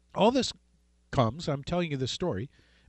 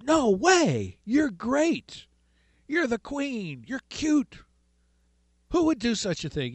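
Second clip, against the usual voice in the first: doting king; 6 semitones higher, slower than usual. Retold or imitated. King answers Queen Esther.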